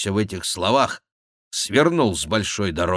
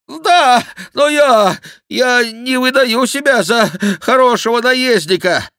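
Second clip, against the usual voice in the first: high, loud, halting complaint; protesting monk; 8 dB louder than usual. A narrator's reading. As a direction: protest loudly in high bursts. (громкий высокий протест монаха)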